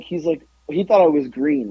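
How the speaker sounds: ordinary talk, nothing marked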